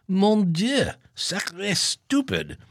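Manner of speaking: put-on hammy French accent exclamation; french chef.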